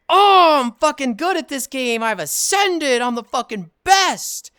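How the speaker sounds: loud, high, slow crowing; hammed-up victory cry with drawn-out words; mock triumphant boast. Player reenacting their Wordle streak, grandiose boasting.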